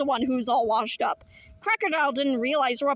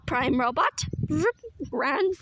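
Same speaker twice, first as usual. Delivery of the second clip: usual speaking voice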